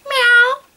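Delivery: high squeaky meow; a tiny kitten mew; little cat meow. A narrator's reading. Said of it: "She even let out a little".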